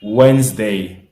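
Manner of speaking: teacher models Wednesday, slow and loud; model pronunciation. Shown as a demonstration. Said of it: "en anglais se dit".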